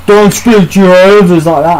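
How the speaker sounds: mocking the uncle's stuffy reprimand; mock scolding uncle. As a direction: put on a pompous elder telling him off. Uncle scolding him, voiced.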